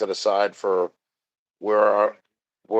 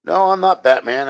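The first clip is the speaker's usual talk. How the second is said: loud mock protest, pitched up; loud joke; 8 semitones higher, 7 dB louder than usual. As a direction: declare it big and silly. Joking Batman-style denial, loud.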